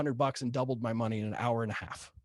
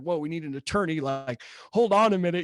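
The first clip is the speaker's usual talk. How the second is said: retold panic; 4 semitones higher, 5 dB louder than usual. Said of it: "the fear starts kicking in".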